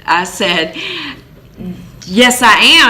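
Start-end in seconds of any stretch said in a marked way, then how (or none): 2.1-2.9 high, loud, beaming yes; so excited. Retold or imitated. New homeowner answering the nosy neighbor, excited and loud. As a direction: burst out proudly like a thrilled new owner. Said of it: "And I was just so excited".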